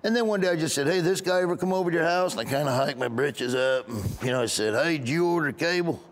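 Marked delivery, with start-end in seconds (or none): 0.9-2.3 lightly voiced installer, higher and quicker; a hint of comic cable installer; cable installer guy. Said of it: "I did this cable installer guy". 4.7-6.0 higher-pitched comic installer at the door; cable installer guy. Acted. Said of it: "I did this cable installer guy".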